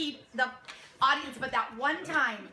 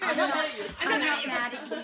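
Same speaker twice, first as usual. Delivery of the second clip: mock protest; 3 dB louder than usual. Shown as a demonstration. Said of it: "they play Emily completely differently".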